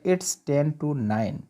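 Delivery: each word drawn out for learners; very slow model sentence. Shown as a demonstration. Teacher showing students how to say the time, very slow. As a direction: dictate it word by word, very slowly. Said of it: "तो क्या बोलेंगे".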